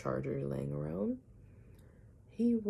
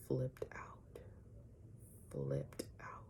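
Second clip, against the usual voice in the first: hushed, breathy whisper; 13 semitones lower, 12 dB quieter, slower than usual. Retold or imitated. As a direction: drop to a secret whisper for effect. Dramatic retelling of neighbor's outburst.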